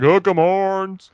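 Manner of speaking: put-on slow, high Southern-accent cheer; drawn-out Texas chant; Texan fan cheer. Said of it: "football fans would be like".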